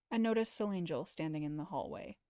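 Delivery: usual speaking voice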